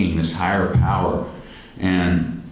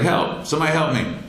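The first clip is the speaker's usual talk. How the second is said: higher-pitched mock yell for help, not full volume; retold yell; 8 semitones higher than usual. Patient calling for someone's attention from the hospital bed, mock yelling. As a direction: imitate calling out for help, restrained. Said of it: "So I started yelling".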